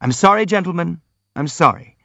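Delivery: mildly flustered, placating; hasty apology. A narrator's reading. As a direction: rush to say sorry. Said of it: "the prince hastened to apologize".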